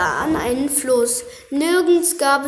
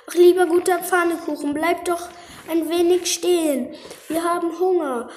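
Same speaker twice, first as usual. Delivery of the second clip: put-on high, pleading little child; 5 semitones higher than usual. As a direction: beg like a hungry child. Hungry orphan children plead.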